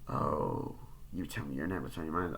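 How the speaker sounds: low, soft, teasing stranger voice; creepy caller. Retold or imitated. Killer teasing Sydney over the phone, low and soft. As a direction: toy with her quietly.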